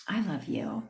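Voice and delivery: soft whispered declaration of love; tender whisper. Shown as a demonstration. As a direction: whisper it softly and sincerely. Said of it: "this is where you can say".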